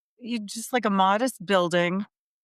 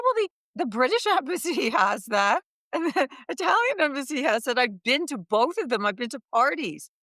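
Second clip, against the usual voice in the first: higher-pitched, insistent pleading of a case; protesting; 5 semitones higher than usual. Retold or imitated. Young American arguing with embassy official over social activities, protesting. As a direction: argue back in mild disbelief.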